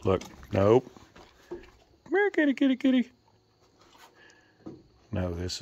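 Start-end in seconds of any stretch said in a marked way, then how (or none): 1.5-3.1 high sing-song kitty call; high-pitched coaxing call to the cat. Real, not acted. Calling the cat again.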